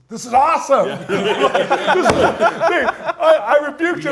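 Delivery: high, loud, laughing excitement; thrilled exclaiming. Retold or imitated. Man thrilled at surviving the witch doctor, loud laughing delight. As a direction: exult loudly, nearly breaking into laughter. Retelling his thrill after the attack.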